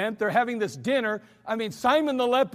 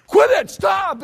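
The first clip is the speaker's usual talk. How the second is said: much louder, higher yell of alarm; alarmed shout; 11 semitones higher, 11 dB louder, slower than usual. Retold or imitated. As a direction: bark it out like a panicked dad. Dad retells alarm at shooting.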